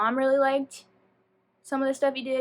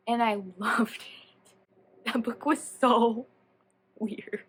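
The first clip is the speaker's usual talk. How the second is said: laughing; much slower than usual. Real, not acted. Laughing about the weird book.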